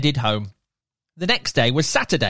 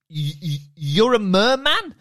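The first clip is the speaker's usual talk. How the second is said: put-on stammering boy; 4 semitones higher than usual. Sammy stammers at merman.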